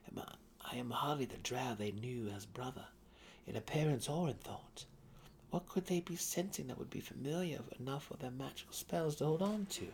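perplexed Drizzt. A narrator's reading. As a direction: wonder it aloud, baffled. Drizzt wonders aloud, perplexed.

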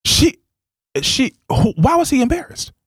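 high, disbelieving question; incredulous. Real, not acted. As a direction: ask in total disbelief.

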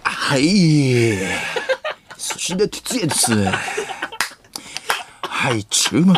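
hammed-up schoolteacher impression; 武田鉄矢. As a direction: draw out the endings like a TV teacher calling the class. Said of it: "武田鉄矢みたいにできるやつもある". (武田鉄矢のものまねで、語尾を伸ばして)

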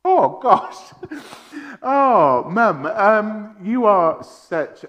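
welling up, voice catching on the words; fighting back tears, with laughing catches; voice breaking. Real, not acted. Son choked up while paying tribute to his strong mum.

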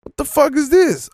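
high startled what the fuck; jolted by the tray slamming down; startled outburst. Retold or imitated. Tray dropped on his table, high startled outburst. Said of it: "So I'm like".

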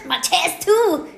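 pushed up high, burning; after a shot of liquor. Real, not acted. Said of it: "my chest is on fire".

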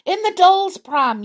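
frightened teddy bear yelling; yelled. A narrator's reading. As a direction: shout the answer across the room. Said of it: "yelled the teddy bear".

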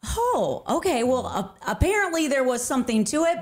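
high "oh", then hesitant acceptance; mock surprised realisation. Giver learning the word worked after all, surprised. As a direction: act out being pleasantly taken aback. Taken aback by the late thanks.

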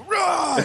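a sudden, sharp, high-pitched mock roar as the blow lands; roaring dad. Retelling the dad's attack on his son, a quick mock roar. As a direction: bark it out like a furious father.

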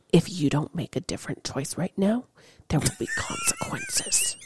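hushed, menacing parental warning; mom whisper. Retold or imitated. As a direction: hiss a quiet threat at a child. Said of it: "I've got the whisper".

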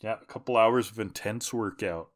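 normal talking voice

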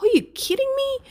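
very high, incredulous retold outburst; frustrated disbelief. Runner seeing the bike broken yet again, frustrated disbelief. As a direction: shoot the pitch right up, exasperated. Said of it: "I was pretty frustrated by this point".

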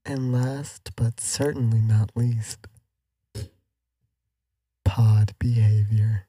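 slow, weighty build to the last name; grand finale. Pretend. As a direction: announce the finale slowly and grandly. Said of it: "That was beautiful".

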